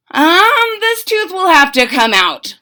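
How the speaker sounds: high, loud, a touch hesitant; announcing dentist. Acted. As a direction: break the news brightly. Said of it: "Doctor DeSoto announced".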